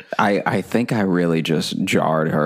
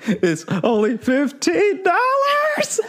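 mock pleading. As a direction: whine it like a desperate man. Mocking his pleading on phone.

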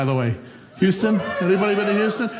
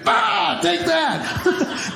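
mock battle cry; 12 semitones higher, much slower than usual. Acted knife attack cry.

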